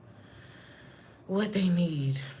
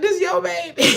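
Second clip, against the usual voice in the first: high, louder nurse line through laughter; laughing nurse; 11 semitones higher, 8 dB louder than usual. Retold or imitated. Nurse handing the baby to mom, high and laughing. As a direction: play the nurse insisting, cracking up. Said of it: "the nurse was like".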